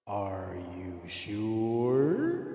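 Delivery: drawn-out echoing challenge, rising at the end; echo chamber. Pretend. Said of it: "echo chambers on this little, um, doohickey".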